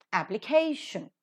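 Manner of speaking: application accented on CA; stress on KAY. Shown as a demonstration. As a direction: model appli-CA-tion as one word for learners. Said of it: "that is to be given accent".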